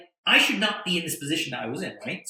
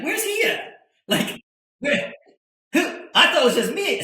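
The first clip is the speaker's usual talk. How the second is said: much higher, bewildered questions; high, louder, stunned disbelief; startled confusion; 12 semitones higher, 7 dB louder than usual. Retold or imitated. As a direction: panic at being the one singled out. Confused thought when introduced.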